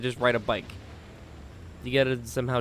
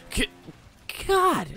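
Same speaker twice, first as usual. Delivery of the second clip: frustrated yelp. Real, not acted.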